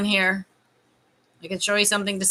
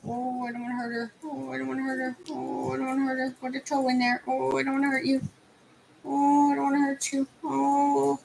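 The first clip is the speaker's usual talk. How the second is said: higher-pitched, gentle fretting refrain; anxious cooing; 5 semitones higher than usual. Real, not acted. Putting a bootie on an injured paw, tenderly fretting. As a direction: soothe the patient in a high little voice.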